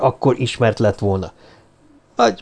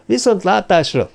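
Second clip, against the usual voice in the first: a much higher goodbye in answer; high-pitched reply; 8 semitones higher than usual. A narrator's reading. Watson answers Stanford's farewell, high-pitched reply. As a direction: return the farewell high, then falling.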